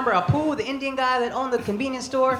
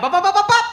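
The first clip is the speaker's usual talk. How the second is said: shooting noises; 10 semitones higher, 6 dB louder, faster than usual. Retold or imitated. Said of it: "I could be like".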